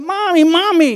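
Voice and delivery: put-on a little boy running to his mother, calling out high; child calling out. Little boy running to mommy, high excited call.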